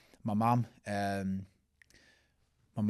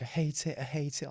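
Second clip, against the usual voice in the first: higher-pitched mock crying, kept light; tearful mum; 5 semitones higher than usual. Son retelling mum hating her new hair, mildly mock-tearful. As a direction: imitate an upset mum complaining. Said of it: "she's really crying".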